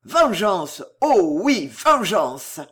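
loud, vehement outburst; forceful, enraged exclamation; furious cry. A narrator's reading. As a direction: cry out for revenge. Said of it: "d'une voix furieuse".

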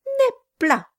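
very high, emphatic verdict. A narrator's reading. Mother-in-law describes the dead man's nose, emphatic. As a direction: pitch it sharply up and declare it.

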